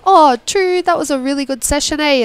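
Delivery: high questioning Kiwi lilt; New Zealand accent. Retold or imitated. Guest imitating New Zealanders, high questioning Kiwi accent. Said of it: "sounds like they're asking a question".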